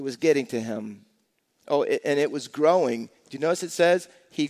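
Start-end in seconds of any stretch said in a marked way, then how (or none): none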